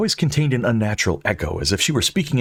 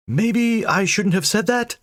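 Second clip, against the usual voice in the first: high, slow sheepish voice; sheepish Dane; 13 semitones higher, slower than usual. Acted. Dane regrets angering the ghost. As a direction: wince it out.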